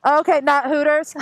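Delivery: loud, high, laughing through it. Real, not acted. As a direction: call it over road noise. Shouting over the helmet intercom.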